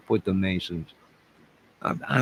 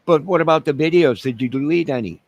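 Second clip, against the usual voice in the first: higher, louder questioning as a detective; playing the interviewer questioning himself, higher and louder; mock interrogator; 7 semitones higher, 6 dB louder than usual. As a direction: grill yourself like a cop. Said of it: "I'm gonna interview myself".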